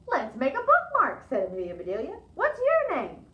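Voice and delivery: high, loud and bright; cheerful offer. Acted. Amelia Bedelia cheerfully offers the girl a bookmark, high and loud. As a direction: cheer her up with eagerness.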